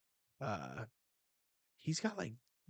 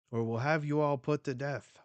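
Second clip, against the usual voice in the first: loud, raised acted threat; mock threat; 4 semitones higher, 8 dB louder than usual. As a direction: threaten like an outraged noble, play-acted. Nobles threatening the Marines.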